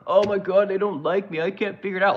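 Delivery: mocking Trump as a whiny little girl; mock crying like a little girl. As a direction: whimper it like a spoiled kid. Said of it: "crying like a little girl".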